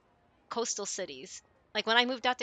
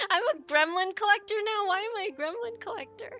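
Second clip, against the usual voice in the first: high, loud laughing protest; amused disbelief; 11 semitones higher, 7 dB louder than usual. Real, not acted. Streamer answering a viewer's nickname for her, amused high-pitched disbelief. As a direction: squeal it, incredulous, coming out of a laugh. Laughing at being called gremlin collector.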